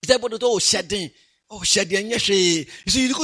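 ordinary delivery

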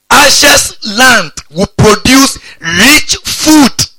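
very slow, high, loud declaration; stretched-out, ringing preacher's emphasis; drawn-out proclamation. Real, not acted. Verse repeated with drawn-out relish.

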